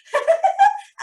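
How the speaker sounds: put-on very high girlish giggle; high-pitched flower-girl giggle. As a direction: let out a silly little giggle.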